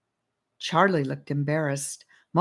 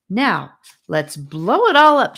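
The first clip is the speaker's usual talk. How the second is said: put-on louder, higher, rallying the kids; eager call; 4 semitones higher, 10 dB louder than usual. A narrator's reading. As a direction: call everyone to join in. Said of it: "she said".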